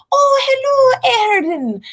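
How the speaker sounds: Irish grandmother stretching "Erin" into many syllables, very high and slow; drawn-out Irish name. Retold or imitated. Imitating her Irish grandmother calling her name, high, slow and sing-song. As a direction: sing "Oh, hello, Erin" like an old Irish nana. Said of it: "she makes it have, like, nineteen syllables".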